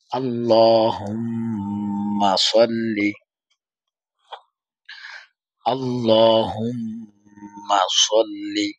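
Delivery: slow, drawn-out Arabic recitation of আল্লাহুম্মা সল্লি; slow recitation demo. Shown as a demonstration. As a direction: stretch আল্লাহুম্মা সল্লি slowly for students to repeat. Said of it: "আমি যখন বলি তখন আপনারা আমার সাথে সাথে বলার চেষ্টা করবেন".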